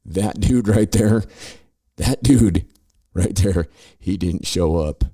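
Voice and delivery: loud, slow, mock-dramatic; sarcastic mock announcement. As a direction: sell the non-event as huge news, sneering. Mocking the big legal news.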